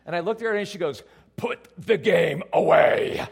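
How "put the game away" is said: loud, slow, furious mock roar; wife in labor. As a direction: bellow it word by word. Wife in labor snaps at him.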